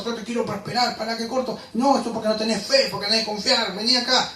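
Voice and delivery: a hint of a wheedling televangelist; mock false preacher. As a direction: mimic a coaxing false preacher, half-mocking. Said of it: "va a decir cualquier cosa".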